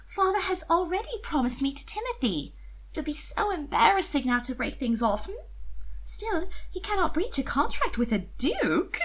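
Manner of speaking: put-on high, light young woman's voice; coaxing older sister, sweetly persuasive; sister's voice. A narrator's reading. Mina's voice held in story.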